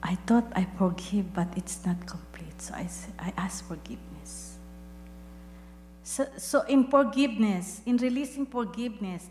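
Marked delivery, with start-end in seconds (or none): 1.3-4.5 hushed confession. Real, not acted. Confessing incomplete forgiveness.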